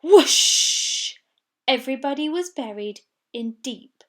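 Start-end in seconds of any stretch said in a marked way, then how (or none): 0.0-1.2 loud breathy whoosh; whooshing avalanche. A narrator's reading. Narrator voices the avalanche, loud breathy whoosh. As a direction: blow out the avalanche.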